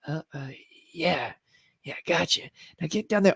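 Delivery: soft, hesitant hushed reply; falter, then keep whispering; whisper acting. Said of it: "you're whisper acting".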